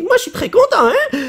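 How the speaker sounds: cri de joie très aigu; joie criée. Real, not acted. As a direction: crie ta joie, voix qui monte très haut. Joie en ouvrant une carte. (crie de joie devant la carte)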